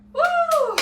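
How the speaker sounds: long, high, loud "woo!"; breathless whoop. Real, not acted. Dancer finishing the recap, out of breath, high whoop. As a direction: let out a big whoop after exertion.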